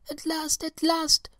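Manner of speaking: very high joyful squeals; joyful babies. A narrator's reading. The baby seeds greet the bees, high and joyful. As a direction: cry it out high with joy. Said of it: "cried the wee green babies joyfully".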